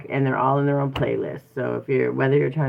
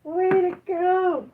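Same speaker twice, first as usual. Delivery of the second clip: cheering praise; 13 semitones higher, much slower than usual. Real, not acted. Praising her child.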